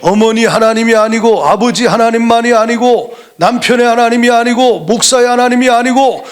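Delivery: high, loud, driving sermon cadence; a Korean pastor hammering each line home; fervent preaching. Acted. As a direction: preach it to the back row. Preacher's rhetorical build-up.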